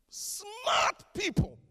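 high, loud, slow stress on smart people; preacher shouting the point to the church; emphatic shout. Real, not acted.

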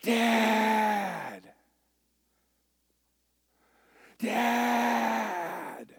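high, drawn-out call of Dad; high, stretched calls for Dad; kid yelling for dad. Retold or imitated. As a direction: cry out like a disgusted twelve-year-old. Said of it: "He goes".

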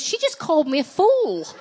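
high, loud comic disbelief; mock shock. Woman reeling at being called a fool, mock-shocked. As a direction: play up the stunned realisation for laughs. Shocked inner thought, played for laughs.

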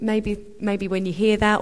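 usual speaking voice

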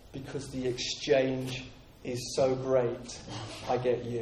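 hushed and heartfelt; sincere surrender. Real, not acted. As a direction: let it sink into a quiet vow. Sincere surrender, spoken softly.

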